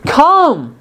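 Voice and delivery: "Come" lifted high and tender; a loving, welcoming "Come". Shown as a demonstration. Pastor voicing Jesus lovingly inviting sinners, high and tender. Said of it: "he's also saying it lovingly".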